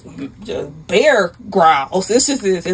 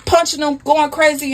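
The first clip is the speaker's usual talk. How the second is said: plain reading voice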